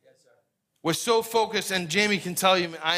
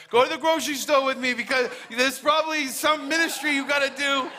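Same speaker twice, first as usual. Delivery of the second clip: higher, laughing playful wife voice; teasing wife; 7 semitones higher than usual. Retold or imitated. Pastor imitates his wife teasing about grocery ministry, higher and laughing. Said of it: "my wife's gonna be like".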